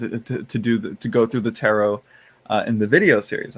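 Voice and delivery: usual speaking voice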